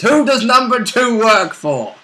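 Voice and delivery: high, slow, hammed-up Dr. Evil style line; Austin Powers impression. Player quoting a silly movie line in a hammed-up villain voice. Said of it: "silly Austin Powers reference".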